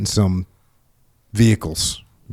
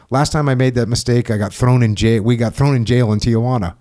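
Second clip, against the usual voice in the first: ordinary talk, nothing marked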